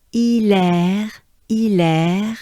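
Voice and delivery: "Hilaire" as ee-lèr, silent H, French r; French name demo. Shown as a demonstration. Teacher giving the saint's first name, French pronunciation. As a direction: say "Hilaire" as ee-lèr, each syllable held. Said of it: "That's the first name".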